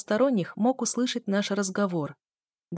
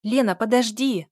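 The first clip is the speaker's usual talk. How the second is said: raised, louder narrated call; 4 semitones higher, 6 dB louder than usual. A narrator's reading. Sister following Lena out, calling out. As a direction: call after someone to wait. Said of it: "закричала сестра".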